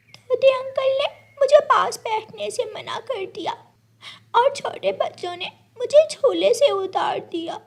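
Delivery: put-on high, aggrieved child's voice; a little girl telling her mother how she was hurt; complaining little girl.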